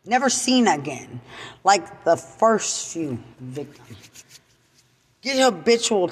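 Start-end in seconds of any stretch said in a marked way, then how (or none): none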